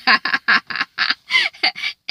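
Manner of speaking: a high gloating laugh. Retold or imitated. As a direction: laugh like a rival who just won. Danny gloating over Kara.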